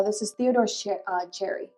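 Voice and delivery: ordinary talk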